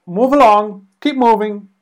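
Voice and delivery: loud, high-pitched herding shout; barked order. Acted. Imitating gruff staff moving passengers along, loud barked order. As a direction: call it out sharply at the crowd.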